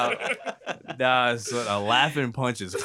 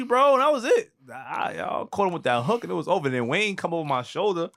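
ordinary talk, nothing marked

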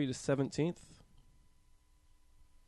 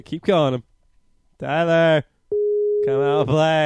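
loud taunting call; 4 semitones higher, 17 dB louder than usual. Retold or imitated. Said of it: "You say".

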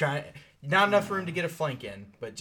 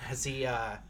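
normal talking voice

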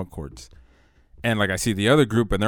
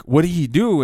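retold yell; 7 semitones higher, 7 dB louder than usual. Retold shout at fighting friends.

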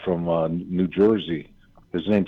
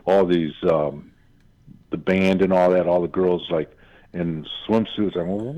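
usual speaking voice

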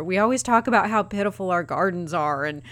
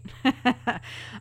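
laughing; 4 semitones higher, 5 dB quieter than usual. Real, not acted. Laughs at own joke.

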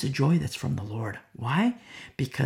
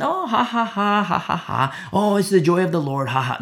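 spoken fake ha-ha laugh; hammed-up hollow merriment; mock laughter; 4 semitones higher than usual. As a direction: imitate a laughing congregation, starting high. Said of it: "And the people laugh".

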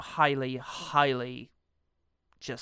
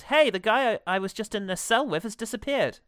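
mock call to the guard, pitched up; acted yelling; 6 semitones higher than usual. Prisoner calls out to the guard, mock yell. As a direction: call out higher, half-yelling. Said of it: "he yells out to the guard".

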